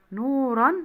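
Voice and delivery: نورًا ending in a loud, clear -an; تنوين بالفتح. Shown as a demonstration. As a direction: ring out the -an of نورًا. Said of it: "هو بالفتح". (نطق نورًا بفتحتين في آخرها)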